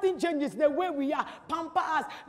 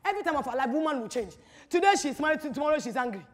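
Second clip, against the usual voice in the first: ordinary talk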